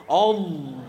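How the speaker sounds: low-pitched takbir, mock-solemn; disruptive takbir. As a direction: intone the takbir low, imitating a worshipper. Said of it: "Mulai takbir, nak ganggu".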